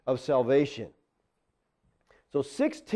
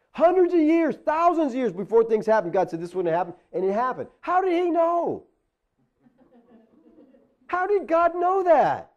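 preaching at full force; 8 semitones higher than usual. Acted. Pastor to congregation on prophecy, full-force preaching. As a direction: lift the congregation with a soaring question. Preaching climax, rising to a cry.

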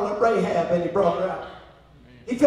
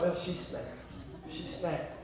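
low, much softer inner thought; hushed aside; 4 semitones lower, 14 dB quieter than usual. Retold or imitated. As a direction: drop to a quiet aside, as if to yourself. His hushed thought on seeing her.

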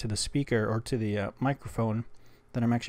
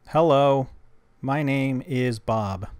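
louder, very slow, spelled-out words; clear dictation into phone; 2 semitones higher, 7 dB louder, much slower than usual. Shown as a demonstration. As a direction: say it slowly and loudly for the app. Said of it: "Did I do this wrong?".

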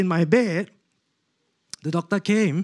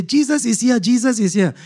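high, louder awed exclamation; retold cry of overwhelmed wonder, pitched up; tearful cry of awe; 7 semitones higher, 5 dB louder than usual. Patient telling wife that Jesus is here, high awed cry. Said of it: "and I'm crying".

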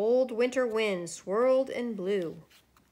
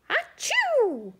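high, loud "achoo"; gigantic acted sneeze; 13 semitones higher, 5 dB louder than usual. A narrator's reading. Snowman rebuilt in the cold wind, gigantic sneeze. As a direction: let out a big pretend sneeze, pitched high. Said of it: "The snowman sneezed a gigantic".

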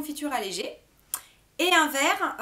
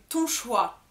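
usual speaking voice

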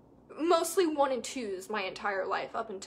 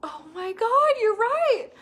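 client's realization; 9 semitones higher, 7 dB louder than usual. Retold or imitated. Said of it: "she was like".

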